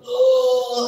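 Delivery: loud high belching shout; imitated belch cry. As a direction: let out a burp like a yell. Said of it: "sendawanya macam orang teriak". (tiruan sendawa keras seperti teriakan)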